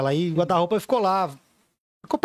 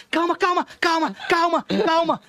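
soothing; 8 semitones higher than usual. Retold or imitated. Calming wife while holding her.

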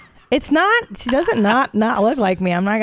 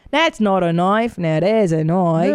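slow mock Australian accent; Crocodile Dundee; slower than usual. Co-host mimicking comic's accent, slow Australian movie-hero voice. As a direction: stretch it out in an imitated Australian accent. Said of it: "Doesn't he sound like".

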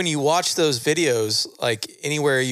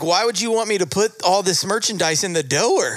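mock confusion; 7 semitones higher than usual. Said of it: "And I'm like".

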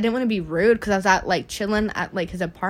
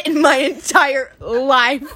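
laughing; 3 semitones higher, 5 dB louder, slower than usual. Real, not acted. Laughing recalling bad breath.

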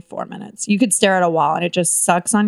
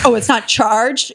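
usual speaking voice

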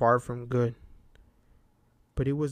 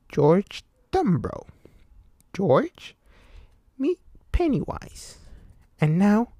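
Pennywise; 4 semitones higher than usual. A narrator's reading. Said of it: "clown introduces himself as Pennywise".

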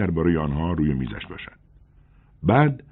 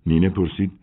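ordinary delivery

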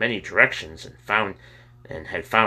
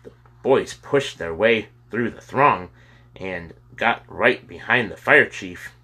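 usual speaking voice

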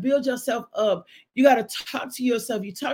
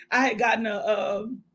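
usual speaking voice

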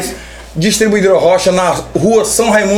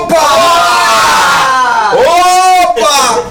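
loud excited "opa"; excited shout; 4 semitones higher, 4 dB louder, much slower than usual. Real, not acted. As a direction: call it out, thrilled. Excited reaction to audio message. (grito animado)

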